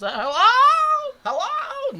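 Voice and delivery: very high, slow quavering hello; drawn-out, very high nervous calling hello; exaggerated Don Knotts. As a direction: call out like a jittery old sitcom man. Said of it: "he does not talk like this at all".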